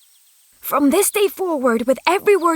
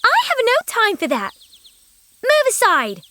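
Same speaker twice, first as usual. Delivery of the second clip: higher, curt and scornful; rude dismissal; 7 semitones higher than usual. Acted. Sophie brushes off the old woman, high and scornful. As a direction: brush her off with contempt.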